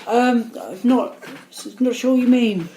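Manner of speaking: high, slow, halting apology; hammed-up helpless politeness; meek road-rage response. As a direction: play the confused, timid driver. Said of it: "an example of how I react to road rage".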